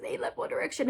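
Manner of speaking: whispered, breathless heartbreak; mock heartbroken whisper. Telling the girls the news, whispered heartbreak. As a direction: breathe it out like a tragedy. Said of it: "And I was just like".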